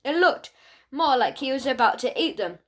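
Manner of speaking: plain reading voice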